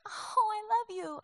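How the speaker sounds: a mock-romantic coo; mock sweet. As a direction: sigh it like a sappy lover. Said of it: "instead of having this".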